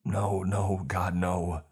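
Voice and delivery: desperate begging. Acted. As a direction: beg in desperation, voice lifted. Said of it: "I begged".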